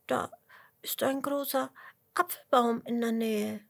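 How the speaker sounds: kleiner Regenwurm. A narrator's reading. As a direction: answer haltingly as a sad little creature. The sobbing little worm answers. (hohe, zögerliche Wurmstimme)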